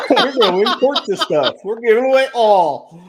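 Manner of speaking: words broken by laughter; laughing. Real, not acted. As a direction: laugh through the line. Mocks the announcer's wording.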